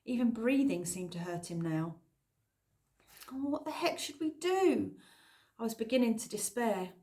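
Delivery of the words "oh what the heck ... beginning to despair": ordinary delivery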